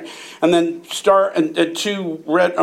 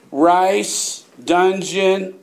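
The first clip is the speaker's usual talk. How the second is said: ordinary talk, nothing marked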